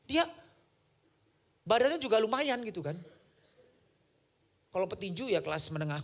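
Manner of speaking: usual speaking voice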